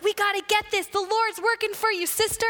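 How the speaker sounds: imitating a fired-up woman, very high-pitched; excited urging. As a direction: urge on a fellow believer with zeal. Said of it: "she's all excited because".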